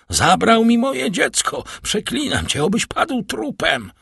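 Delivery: high, loud frenzied outcry; screamed curse. Acted. Father cursing the man who took his child, frenzied scream. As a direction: curse him at the top of your voice. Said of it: "wykrzyknął Villefort".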